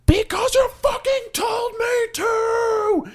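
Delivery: huge high slow yell; screamed. Retold or imitated. Yelling back at his girlfriend through her car window, a huge slow scream. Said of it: "and I screamed".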